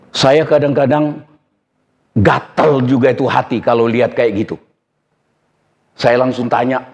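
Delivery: usual speaking voice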